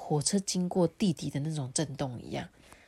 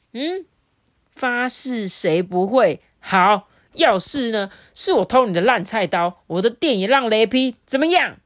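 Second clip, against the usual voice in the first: defiant retort; 5 semitones higher, 7 dB louder than usual. Acted. Younger brother daring his brother with a counter-oath, defiant. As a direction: throw the oath back in his face.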